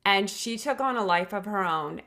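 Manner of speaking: ordinary talk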